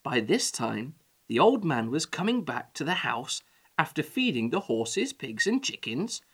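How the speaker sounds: ordinary delivery, nothing marked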